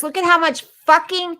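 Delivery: "fucking" blurted out high and heated; angry swearing. Real, not acted. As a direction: let the curse slip out in anger. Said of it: "excuse my language".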